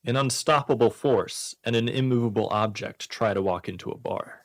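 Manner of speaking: ordinary delivery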